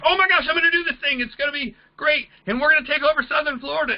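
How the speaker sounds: high, fast, gushing excitement; imitating excited recruit. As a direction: mimic a thrilled new recruit bubbling over. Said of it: "he calls us super excited".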